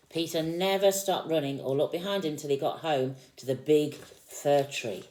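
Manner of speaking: put-on lively read-aloud to a small child; storybook reading. A narrator's reading. Parent reading Peter Rabbit to a child, lively storytelling. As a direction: read the picture book out with energy.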